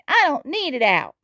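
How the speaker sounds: high, whiny refusal; sulking. A narrator's reading. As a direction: protest like a sulky child. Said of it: "sulked Tiny".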